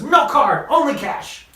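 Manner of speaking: high, emphatic cashier's refusal; curt cashier. Retold or imitated. Curt cashier refusing his card.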